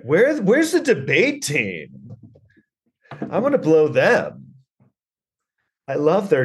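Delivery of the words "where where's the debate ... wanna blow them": high, drawn-out imitation of fawning girls; mock groupie girls. College girls hunting for the debate team, mock-fawning. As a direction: play eager groupies in a higher voice. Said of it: "the hottest chicks were like".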